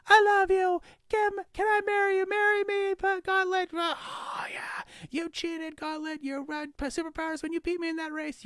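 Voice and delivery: adoring fan yelling at Gauntlet, high and loud; screaming fan. Acted. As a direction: gush like a starstruck fan. Fans shouting at heroes.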